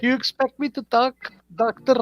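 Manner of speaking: lightly acted high movie quote; mildly hammed film hero's question; James Bond. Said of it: "He's like".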